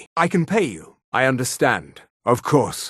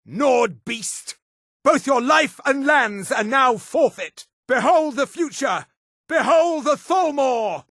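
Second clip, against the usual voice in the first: high, shouted battle taunt; villainous proclamation; 7 semitones higher than usual. Acted. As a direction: proclaim victory over a despised foe.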